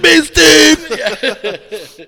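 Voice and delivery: high, loud laughing mock yell; mad friend yelling. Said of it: "that one made him mad".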